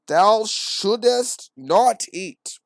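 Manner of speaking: high, loud, drawn-out archaic declamation; pretentious. Acted. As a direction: pronounce it slowly with pompous grandeur. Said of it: "Why you gotta talk like that?".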